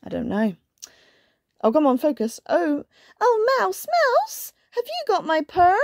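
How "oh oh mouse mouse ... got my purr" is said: high, pleading kitten voice; squeaky storybook kitten; little kitten. A narrator's reading. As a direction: ask it like a tiny anxious kitten. Kitten asks mouse in story.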